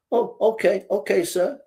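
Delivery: high-pitched, submissive mouse; meek little mouse. Acted. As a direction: give in meekly in a small high voice. Little mouse gives in to bully.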